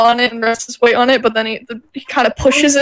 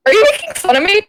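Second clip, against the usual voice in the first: higher-pitched, mock-affronted question; mildly offended; 7 semitones higher, faster than usual. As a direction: act a little wounded at being teased. Character offended at being laughed at.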